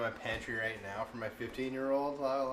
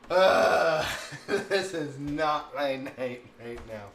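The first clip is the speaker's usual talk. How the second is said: higher and louder, a laughing ugh and slow words; exasperated laughing groan; 4 semitones higher, 10 dB louder, much slower than usual. Real, not acted. Mom telling followers about potty chaos, laughing in exasperation. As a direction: laugh it off, completely worn out. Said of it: "And I was like".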